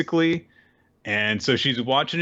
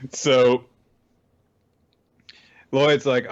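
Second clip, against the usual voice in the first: ordinary talk, nothing marked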